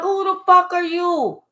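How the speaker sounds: a hammed-up shocked stranger; mock outrage. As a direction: play the shocked crowd. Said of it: "They like".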